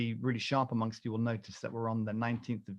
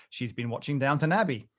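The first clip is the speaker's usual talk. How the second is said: ordinary delivery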